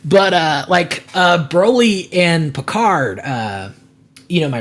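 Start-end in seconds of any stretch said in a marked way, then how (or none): none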